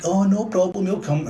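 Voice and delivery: high, loud sneering mock of an eager lackey; jeering impression of Britain obeying America. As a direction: squeak it like a yes-man eager to please.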